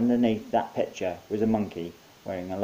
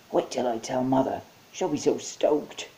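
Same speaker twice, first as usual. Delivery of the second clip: higher, eager soldier voice; excited young soldier; 5 semitones higher than usual. Acted. Soldier thrilled to tell his mother. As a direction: gush like a kid.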